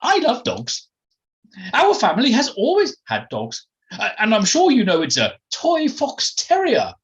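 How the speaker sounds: very high, loud, haughty aristocrat; Felicity Forsyth Twike, aloof. Acted. Posh lady boasting of her family and its dogs, haughty. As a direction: pronounce it with a schoolmistress's lofty authority. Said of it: "with the aloof authority of a schoolmistress".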